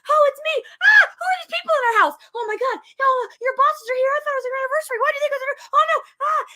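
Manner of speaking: high, flustered housewife shriek; mock panic. Acting Wanda startled by dinner guests.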